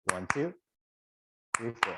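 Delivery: a tiny comma pause after two. Shown as a demonstration. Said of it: "So these commas".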